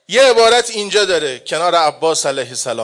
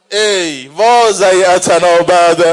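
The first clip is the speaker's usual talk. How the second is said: very slow, louder drawn-out lament; mourning cry; 5 dB louder, much slower than usual. Acted. Hussein answering his sister, slow loud lament. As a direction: draw each word out in grief. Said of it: "فرمود".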